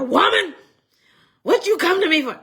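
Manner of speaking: mock harsh son snapping at his mother; ugly harsh rebuke. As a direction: bark it at her like an ugly rebuke. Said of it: "he said it in an ugly way".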